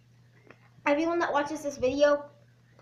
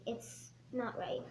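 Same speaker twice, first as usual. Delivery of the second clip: hushed, slow whisper; 4 semitones lower, 14 dB quieter, slower than usual. Real, not acted. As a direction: whisper it earnestly. Serious anti-bullying message.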